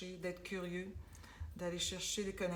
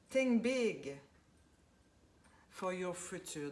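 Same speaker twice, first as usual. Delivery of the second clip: emphatic card motto; 5 dB louder, slower than usual. Shown as a demonstration. Reads card motto aloud.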